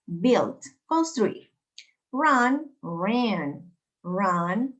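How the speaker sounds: isolated words with pauses between; pronunciation drill. Shown as a demonstration. Teacher modelling verbs to practise at home, pronunciation drill. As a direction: model each verb pair clearly for repetition. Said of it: "que tú también los pronuncies en casa".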